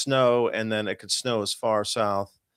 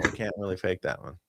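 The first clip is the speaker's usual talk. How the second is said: usual speaking voice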